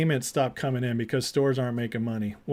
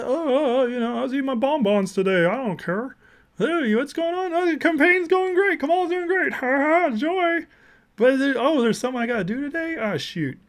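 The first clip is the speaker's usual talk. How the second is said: much higher, sing-song mock voice of a careless official; ditzy, carefree parody; mocking airhead official; 9 semitones higher than usual. Mocks the commerce secretary's carelessness.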